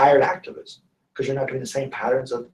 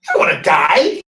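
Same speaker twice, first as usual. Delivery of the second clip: mock protest; 2 semitones higher, 7 dB louder than usual. Client protests loudly.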